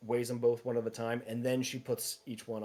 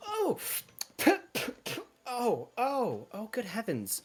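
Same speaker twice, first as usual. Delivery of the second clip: high, loud startled ohs; shocked by the taste; 9 semitones higher, 5 dB louder than usual. Acted. Shopkeeper reacting to customer's tea sample, shocked and high. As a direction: recoil from a horrid sip, pitch leaping.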